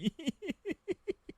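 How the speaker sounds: หัวเราะคิกคักเสียงสูงเบาๆ; หลุดขำเสียงสูงออกมา; หัวเราะเสียงแหลม. Real, not acted. (หัวเราะเบาๆ เสียงสูงกับเกม)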